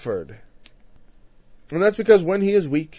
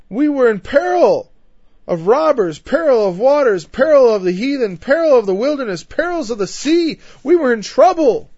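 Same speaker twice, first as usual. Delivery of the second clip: rising, louder and higher pleading to the Lord; impassioned plea; 7 semitones higher, 5 dB louder than usual. Acted. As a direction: cry out each peril like a man pleading his case. Paul voiced listing his sufferings.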